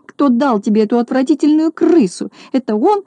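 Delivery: high, outraged questioning; acted high-pitched outcry; crying out. A narrator's reading. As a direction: demand it in a high, indignant voice. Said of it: "закричала она".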